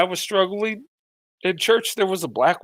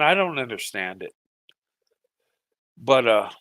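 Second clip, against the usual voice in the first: holding back tears; 7 semitones lower, 4 dB quieter than usual. Real, not acted. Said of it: "I'm just having a moment tonight".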